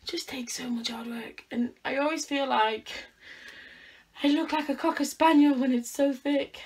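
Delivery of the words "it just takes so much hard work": whispered, almost no voice. Real, not acted. Vlogger to viewers about her thick hair, a tired whisper.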